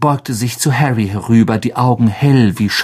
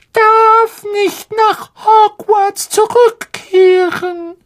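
sehr hohe, langsame, tränenerstickte Elfenstimme; weinerlicher Hauself. Acted. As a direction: flehend und den Tränen nah. Dobby tearful plea. (Dobby beschwört Harry unter Tränen, sehr hoch und langsam)

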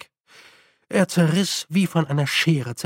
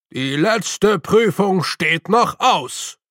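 solemn objection; 4 semitones higher, 4 dB louder, slower than usual. A narrator's reading. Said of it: "wandte der hochwohllöbliche Meister ein".

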